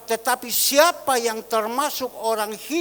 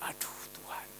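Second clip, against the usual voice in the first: a soft, breathless whisper of dismay; whispered sigh; 17 dB quieter than usual. Retold or imitated. Pastor whispering to God on finding no money, hushed. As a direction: breathe it out in quiet despair.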